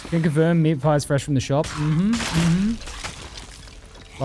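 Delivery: ordinary talk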